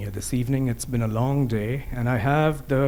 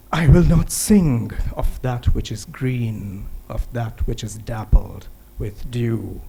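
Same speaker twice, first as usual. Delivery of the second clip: poem recitation; 3 dB louder than usual. Acted. Poet reciting a protest verse on Kashmir, solemn declamation. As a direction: push each refrain out firmly, then let it drop.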